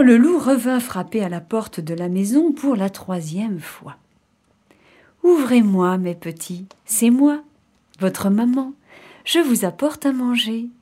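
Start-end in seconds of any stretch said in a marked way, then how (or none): 5.2-10.7 wolf's gentle mother imitation; soft sweet voice. Wolf coaxes kids a third time, sweet soft voice. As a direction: call gently, lilting up. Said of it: "de sa voix douce".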